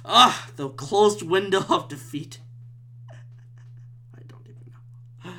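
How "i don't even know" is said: slow, barely audible whisper; defeated whisper. Real, not acted. Podcaster after closing the window, slow defeated whisper.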